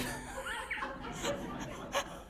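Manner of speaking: high breathless wheezing laugh; helpless airy giggle; burst of laughter. Real, not acted. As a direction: lose it into a silent, wheezy laugh. Laughing mid-story.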